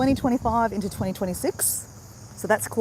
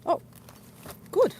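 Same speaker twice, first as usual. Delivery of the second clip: normal talking voice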